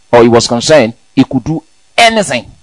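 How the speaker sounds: ordinary talk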